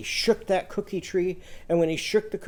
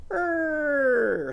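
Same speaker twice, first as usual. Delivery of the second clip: a much higher err like a scooter turning; 10 semitones higher than usual. Shown as a demonstration. Irving turning his scooter, high motor err. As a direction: make the scooter's err as it turns. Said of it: "he's going".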